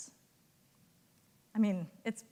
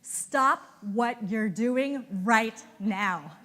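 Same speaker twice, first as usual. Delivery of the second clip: each word slow and hammered, louder; all caps, slow and louder; 6 dB louder, much slower than usual. Retold or imitated. Head of market access's all-caps email, slow and hammered. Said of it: "it's in all caps".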